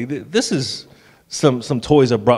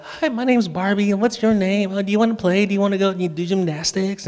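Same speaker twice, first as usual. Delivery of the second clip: higher-pitched imitation of young girls; little girls playing Barbies; 6 semitones higher than usual. Dad imitating daughters role-playing with Barbies, high girlish doll voice. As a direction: chirp it like a girl voicing her doll. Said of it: "the girls were like".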